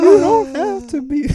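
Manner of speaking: hammy high laughing reply. Pretend. Hammed-up response, then laughing.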